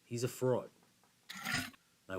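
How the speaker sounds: normal talking voice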